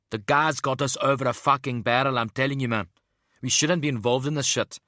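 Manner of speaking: loud, high South African ranting; South African accent. A narrator's reading. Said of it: "had a strong South African accent".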